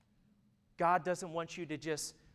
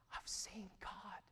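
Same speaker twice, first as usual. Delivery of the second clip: awed whisper; 5 semitones higher, 14 dB quieter, slower than usual. Retold or imitated. Kid awed during worship.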